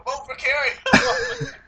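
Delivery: high, slow teasing quote breaking into laughter; laughing mock plea. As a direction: imitate a friend's campaigning while cracking up. Quotes co-host's campaigning, cracking up.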